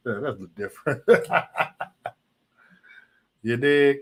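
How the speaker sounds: ordinary talk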